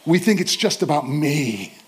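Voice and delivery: normal talking voice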